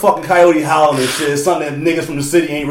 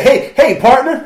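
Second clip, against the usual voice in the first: a hint of a higher, twangy stranger greeting; 9 semitones higher, much slower than usual. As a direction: lightly voice a friendly stranger saying hi.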